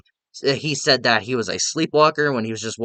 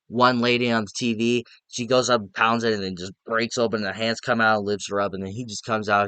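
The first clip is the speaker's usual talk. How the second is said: usual speaking voice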